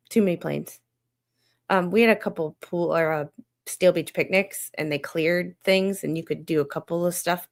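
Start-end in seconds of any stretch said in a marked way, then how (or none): none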